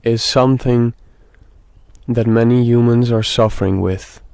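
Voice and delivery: slow meditation talk with very long pauses; speaking slowly. Real, not acted. Guide tells dear friends about guilt, slow and meditative. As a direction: let each phrase hang in silence before the next. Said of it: "we are speaking rather slowly".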